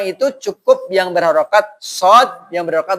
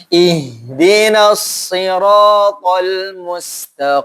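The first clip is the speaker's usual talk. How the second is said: slow, chanted tajwid recitation; Quran recitation; much slower than usual. Shown as a demonstration. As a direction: recite the verse slowly, melodically.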